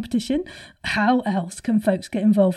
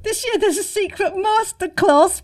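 excited reveal; 8 semitones higher, 5 dB louder than usual. Real, not acted. Teasing big reveal.

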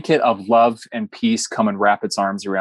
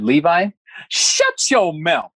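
higher, drawn-out retold telling-off; mock scolding; 7 semitones higher, much slower than usual. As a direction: imitate the wife cutting him short, playfully stern. Wife scolds him, retold.

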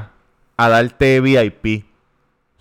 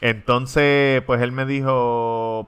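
ordinary talk

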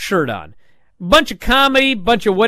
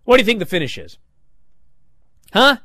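ordinary delivery

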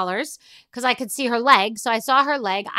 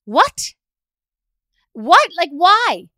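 high, loud what, what; can't believe it; mock outrage at tech cluelessness; incredulous; 7 semitones higher, 7 dB louder, much slower than usual. Real, not acted. Incredulous at a coworker's voicemail.

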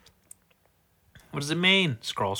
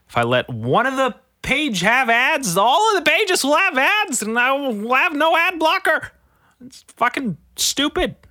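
high, loud frustrated rant; angry rant; 13 semitones higher, 5 dB louder than usual. Real, not acted. Ranting at news sites over ads, angry and loud. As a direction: rant at the ad-blocker demands.

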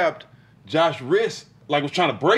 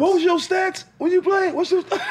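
mock heckling. Teammate mimicking a trash talker demanding an opponent's stats, high mock heckle. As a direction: heckle in an exaggerated high voice, about to crack up.

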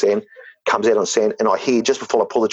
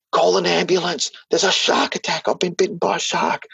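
much higher, imitating the brother's panicked call; imitated cry for help to the fishermen, much higher; retold panicked yell; 9 semitones higher than usual.

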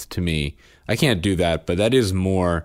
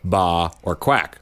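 ordinary delivery

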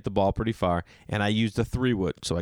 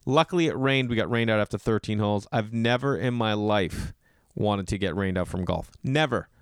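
ordinary talk

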